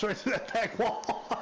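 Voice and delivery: can't hold back a laugh telling it; laughing while quoting. Real, not acted. Officer retelling his sergeant's threat, laughing through it. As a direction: quote it through real laughter.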